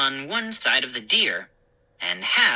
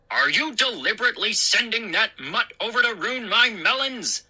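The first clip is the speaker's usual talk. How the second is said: accusing call; 6 semitones higher than usual. A narrator's reading. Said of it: "Mister McCall called".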